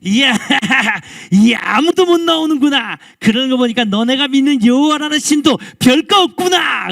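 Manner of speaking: loud, high mocking shout; boastful giant taunting with contempt; scornful Goliath. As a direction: jeer at a cowering army. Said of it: "이스라엘을 조롱하고 이스라엘 하나님을 멸시하면서".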